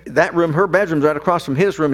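usual speaking voice

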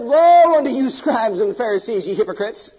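loud, high cry on woe, then settling; thundering rebuke. Acted. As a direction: rain judgment on the Pharisees. Voices Jesus rebuking Pharisees.

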